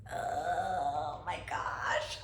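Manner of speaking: soft, slow, tearful whisper; breathless crying whisper. Retold or imitated. Hearing the rupture risk, a crying whisper. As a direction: barely breathe it out through tears. Shock at surgery news.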